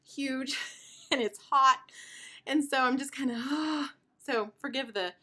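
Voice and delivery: breathless with sighs; out of breath. Real, not acted. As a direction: sigh through the words, short of breath. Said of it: "forgive the deep breaths and all of the sighing".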